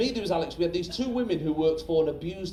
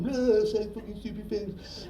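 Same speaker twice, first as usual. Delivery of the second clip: higher, drawn-out goofy inner voice; jokey brain voice; 5 semitones higher, slower than usual. Acted. Comic's brain egging him on, drawn-out silly voice. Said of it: "It's like us going".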